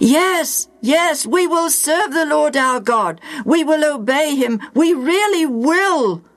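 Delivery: high, earnest pledging; eager promise. Acted. The people promising Joshua, high eager pledge. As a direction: plead that you mean it. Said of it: "the people promised".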